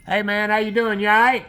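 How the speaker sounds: loud, high call across the yard. Real, not acted. Preacher calls out to a friend arriving in his yard, loud and friendly. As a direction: shout a friendly hello to someone outside.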